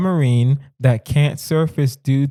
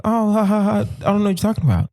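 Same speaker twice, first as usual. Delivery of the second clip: higher-pitched mock laugh into denial; feigned innocence; 6 semitones higher, much faster than usual. Friend brushing off the fake-accent complaint, playful mock innocence. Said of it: "you're gonna be like".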